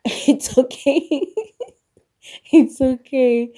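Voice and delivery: laughing it off. Real, not acted. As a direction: laugh away the embarrassment. Laughing off not crying.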